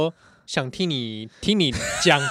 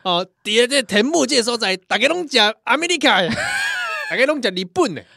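mock food show host; 8 semitones higher than usual. Cohost joins the Tianmu food pitch, hammed-up promo.

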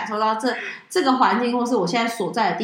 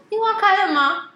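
lightly higher, slower voice for the coworker asking; mock coworker voice; 10 semitones higher than usual. Coworker texting to ask whether the blossoms bloomed, mock high voice. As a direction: play the curious coworker, a touch higher and drawn out.

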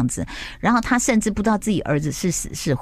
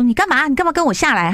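startled demand; 8 semitones higher, 4 dB louder than usual. Retold or imitated. Rescuer demands why other jumped.

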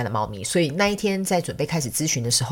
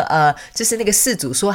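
ordinary delivery

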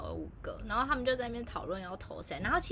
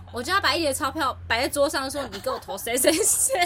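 mock determined coworker; 4 semitones higher, 9 dB louder than usual. Coworker quoted planning to bribe dad.

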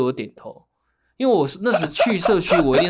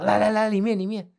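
put-on Taiwanese accent; 6 semitones higher than usual. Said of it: "还帮我推着门哦".

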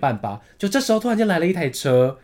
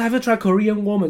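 higher-voiced mimicry of accented English; heavy taxi-driver accent; 5 semitones higher than usual. Korean taxi driver asks passenger yet again, accented English. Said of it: "他口音有点重".

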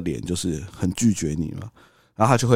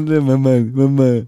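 put-on toddler call; 3 semitones higher, 3 dB louder than usual. Imitating the child calling.

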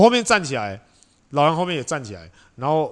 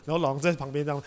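ordinary delivery

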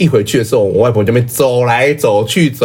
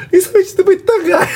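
very high, laughing mock scold; imitating mom on phone. Mom phoning her younger brother, high laughing scold. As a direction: pitch it way up and tease-scold through laughter. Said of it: "打电话跟我小舅说".

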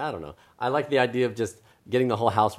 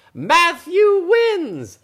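high, slow, loud proclamation; yelled announcement; 9 dB louder, much slower than usual. Acted. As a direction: yell the winner out, stretching it. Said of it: "they yelled".

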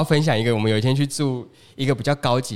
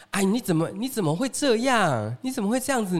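higher-pitched exasperated wife voice; imitating nagging wife; 9 semitones higher than usual. Retelling the wife scolding her husband, high mock nagging.

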